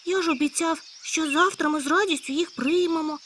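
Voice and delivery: a hare who has made a promise he regrets; in despair. Acted. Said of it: "з подивом, у повному розпачі". (у повному розпачі, вище)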